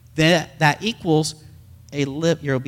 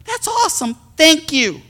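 put-on much higher, delighted child's thanks; excited kid; 11 semitones higher than usual. Son Radley thrilled about ice cream, excited child's voice. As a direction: gush like a thrilled little boy. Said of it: "his first response was".

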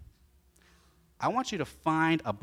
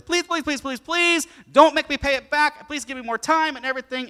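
high, loud desperate pleading; begging debtor; 12 semitones higher, 10 dB louder than usual. Acted. Preacher voicing the debtor begging his master for mercy. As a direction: beg frantically for mercy.

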